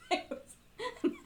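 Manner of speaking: laughing high while speaking. Real, not acted. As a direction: laugh through the words. Laughing at own story.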